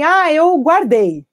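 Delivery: mock-guilty confession; sheepish parent. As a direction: drag it out like an embarrassed parent. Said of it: "E daí eu falo assim".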